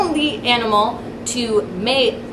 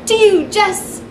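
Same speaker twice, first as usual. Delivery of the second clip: excited handoff; 8 semitones higher, 3 dB louder, slower than usual. Acted. Anchor hands off to reporter.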